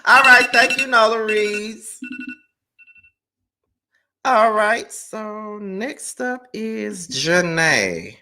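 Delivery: high singsong handover to the next caller; show host announcing. Real, not acted. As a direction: draw out the name in a high, lilting voice.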